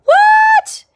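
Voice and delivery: squealed high and loud; a stunned high-pitched yelp; shocked what. Retold or imitated. Seeing the Thank God tag, shrieking in surprise. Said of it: "And I went".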